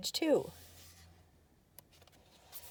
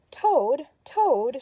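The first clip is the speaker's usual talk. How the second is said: loud high call to wake him; shouting; 6 semitones higher, 7 dB louder than usual. A narrator's reading. Frog shouting at Toad's door to wake him. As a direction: call out loudly to a sleeping friend. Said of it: "shouted Frog".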